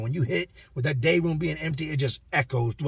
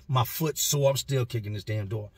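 ordinary talk

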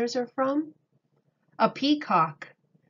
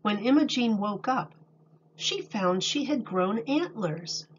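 calm storybook reading voice; reading to children; 3 dB louder than usual. A narrator's reading. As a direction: read it to a class of little kids. Reads picture book aloud.

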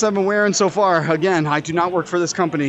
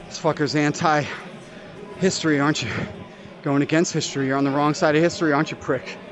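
lower, softer jeering; low muttered taunt; 5 semitones lower, 6 dB quieter than usual. Real, not acted. Gamer quietly taunting the beaten player, low and soft. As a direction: murmur the jab at the loser.

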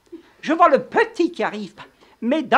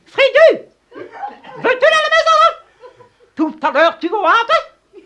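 very high loud scolding yell; scolding the dog; 9 semitones higher, 6 dB louder than usual. Acted. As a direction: shout at the dog to go home. (gronder le chien très fort)

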